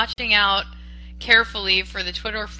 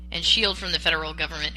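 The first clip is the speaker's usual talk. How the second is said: ordinary delivery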